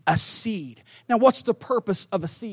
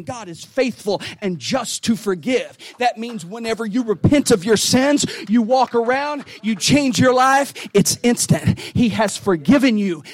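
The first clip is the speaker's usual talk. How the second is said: building to loud, higher preaching shouts; preaching at full force; 3 semitones higher, 7 dB louder than usual. Real, not acted. Pastor urging the congregation to accept forgiveness, shouting. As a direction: drive it home to the pews, louder each line.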